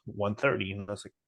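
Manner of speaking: ordinary talk, nothing marked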